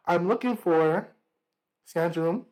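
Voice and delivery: ordinary delivery, nothing marked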